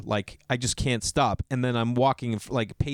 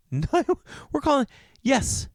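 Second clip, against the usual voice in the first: mock frantic reply; 13 semitones higher than usual. Wife insisting to husband that they're calling. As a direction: snap back that you're already calling.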